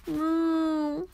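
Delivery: a lightly voiced calf's moo; mock moo. A narrator's reading. Calf stuck in a ditch, a mild mooing cry. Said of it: "a calf cried".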